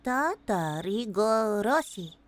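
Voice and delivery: "Tatarigoroshi" slow and low, syllable by syllable; slow careful sounding-out. Shown as a demonstration. Streamer attempting a hard title, "Tatarigoroshi" slowly sounded out. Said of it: "that's very hard to say. Hold on".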